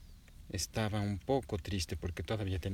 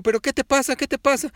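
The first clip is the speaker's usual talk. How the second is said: higher, louder alarmed dad; papá alarmado; 10 dB louder than usual. A narrator's reading. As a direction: ask fast and worried. (papá asustado, más agudo y fuerte)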